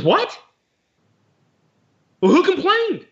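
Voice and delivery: high-pitched mock disbelief; retold bafflement. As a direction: act out being completely thrown, pitch jumping up. Retold baffled reaction to seller's denial.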